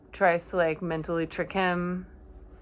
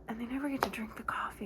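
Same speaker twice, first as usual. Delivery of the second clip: fast, hushed whisper; whispered lament, quick; 4 semitones higher, 10 dB quieter, much faster than usual. Real, not acted. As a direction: rush it out in a whisper. Sad aside about the coffee.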